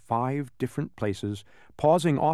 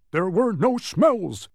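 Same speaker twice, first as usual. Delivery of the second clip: loud, high, drawn-out dog's bark; 7 semitones higher, 5 dB louder, slower than usual. A narrator's reading. Tock the dog, trick discovered, barking. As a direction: bark the words out slowly and loudly. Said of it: "barked Tock".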